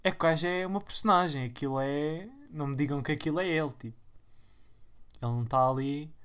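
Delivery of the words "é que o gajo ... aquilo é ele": ordinary talk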